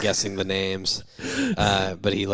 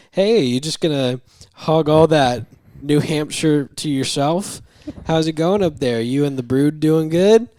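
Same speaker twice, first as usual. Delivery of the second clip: loud, higher, breezy small talk; imitated chummy greeting; 6 semitones higher, 7 dB louder than usual. Patient phones vacationing doctor, acted-out chummy cheer. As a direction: play an overfamiliar caller checking in.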